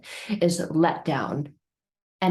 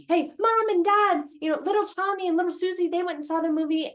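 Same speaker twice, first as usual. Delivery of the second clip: put-on much higher, eager kid calling out; excited child calling; 10 semitones higher than usual. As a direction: shout it to mom like a thrilled kid.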